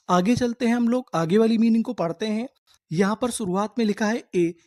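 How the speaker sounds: normal talking voice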